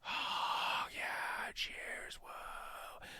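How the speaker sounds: hushed crowd cheers; whispered crowd. Acted. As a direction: breathe it like a distant crowd. Crowd reaction voiced by GM.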